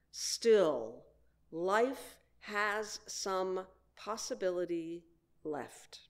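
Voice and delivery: reading a poem aloud with swelling emphasis. Acted. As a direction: read the poem as if offering it to the congregation. Reads Mary Oliver poem aloud.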